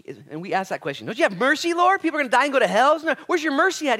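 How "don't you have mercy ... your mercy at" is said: loud, high, urgent challenge; indignant high-pitched appeal; protesting plea. Acted. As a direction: plead with God at a shout. Voicing people pleading with God.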